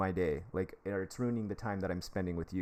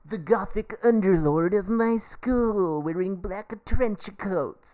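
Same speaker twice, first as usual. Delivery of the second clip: much higher, slow, mock-dramatic self-parody; hammed-up goth persona; 10 semitones higher, 8 dB louder, slower than usual. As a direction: ham up the gothic underlord. Self-mocking his teenage goth persona.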